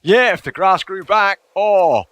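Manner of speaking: excited longing. Real, not acted. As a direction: gush like it's paradise.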